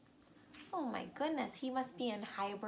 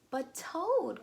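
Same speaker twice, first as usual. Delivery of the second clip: louder, higher plea; pleading cry; 5 semitones higher, 6 dB louder than usual. Acted. Frog crying out to Toad going back to bed, loud plea. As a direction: cry out to stop him. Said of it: "cried Frog".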